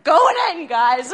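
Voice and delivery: high, loud, laughing battle cry; gung-ho. Retold or imitated. Bassist to bandmates before diving in, gung-ho and laughing. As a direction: announce the plunge like a hero.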